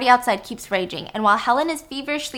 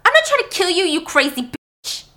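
high, louder angry yell; scream; 8 semitones higher, 5 dB louder than usual. Acted. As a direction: scream back at her attacker. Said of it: "Helen screams".

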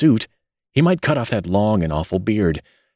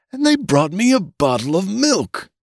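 put-on much higher old man's indignant protest; shrill protest; 13 semitones higher than usual. A narrator's reading. Old newborn complaining about the milk, high indignant protest. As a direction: rise into outraged protest. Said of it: "his voice rose to a shrill note of protest".

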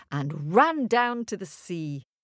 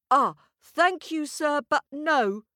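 put-on quipping boy; 9 semitones higher, 4 dB louder than usual. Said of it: "quipped Jack".

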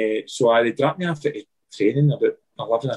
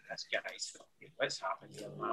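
breathy whisper, alarmed; whispered thought; 6 semitones lower, 20 dB quieter than usual. Retold or imitated. Thinking in the car as the drunk driver jolts, whispered. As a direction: whisper it to yourself in disbelief.

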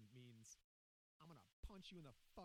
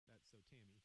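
ordinary delivery

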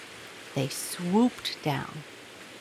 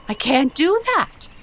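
much higher, much louder outcry; crying out; 9 semitones higher, 9 dB louder than usual. Acted. King refusing to give away his things, crying out high and loud. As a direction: cry out in horror at the idea. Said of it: "cried the king".

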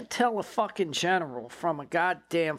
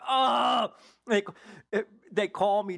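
retold shocked shout; 5 semitones higher than usual. Agent hearing the target's gone, shouting in shock. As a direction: blurt it out like the floor just dropped.

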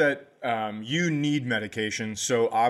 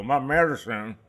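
mock backwoods drawl; hillbilly drawl; 2 semitones higher than usual. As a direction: slur it into a country drawl. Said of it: "when you go".

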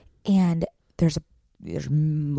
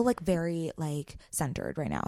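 quiet at night; 9 dB quieter than usual. Real, not acted. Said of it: "so that's why I'm very quiet".

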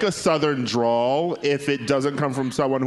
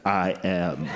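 dramatic reveal; 9 semitones lower than usual. Retold or imitated. Secret dad reveals himself to daughter, low and dramatic. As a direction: drop it low and slow like a cliffhanger. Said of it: "he looks at her dramatically and says".

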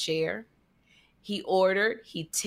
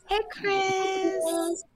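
high sweet greeting; 8 semitones higher than usual. Shown as a demonstration. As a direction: voice pitched up, sweet and tender. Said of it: "That's what it is".

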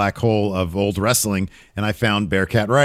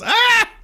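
high-pitched comic yelp, half laughing; mock freak-out scream; 4 dB louder than usual. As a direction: imitate a panicked commentator's scream, played for laughs. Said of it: "Graves freaks out".

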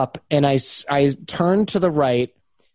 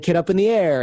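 ordinary talk

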